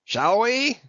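high defiant call to the men; cried out. Acted. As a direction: call out to your crew. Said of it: "cried the ringleader to his men".

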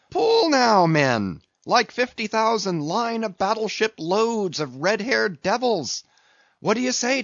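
high-pitched, fervent rallying call; rousing cry. A narrator's reading. Stubb challenging Tashtego and his men to pull, rousing cry. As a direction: fire up the crew, pitched high throughout. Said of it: "cried Stubb".

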